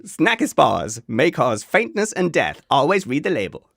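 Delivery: put-on Yank advert voice; American accent. Host reading a joke side-effects warning for Snacky Spas, in exaggerated American accent. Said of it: "Anyone can do a Yank accent".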